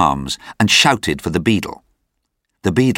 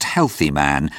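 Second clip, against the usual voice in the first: ordinary delivery, nothing marked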